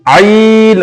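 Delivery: "आइन" pressed from the throat, with a long ee; throaty आइन. Shown as a demonstration. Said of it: "यहां गले में". (हलक से ज़ोर लगाकर "आइन")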